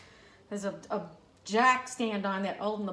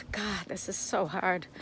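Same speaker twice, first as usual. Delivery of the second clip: grieving; 4 semitones lower, 7 dB quieter than usual. Real, not acted. Grief returning.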